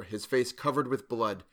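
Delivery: ordinary delivery, nothing marked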